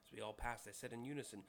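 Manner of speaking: ordinary talk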